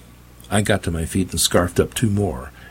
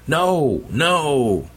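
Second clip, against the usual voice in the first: desperate cries; 8 semitones higher than usual. Acted. Preacher losing control.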